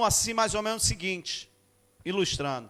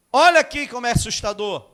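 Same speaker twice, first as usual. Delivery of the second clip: louder and higher, building toward the climax; rising preaching; 7 semitones higher, 6 dB louder than usual. Acted. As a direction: start to lift the sermon. Building into full-force preaching.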